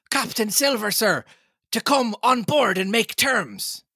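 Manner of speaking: high-pitched hail from a distance; shouted. A narrator's reading. Mutineer shouting a message to the captain, hailing. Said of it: "he shouted".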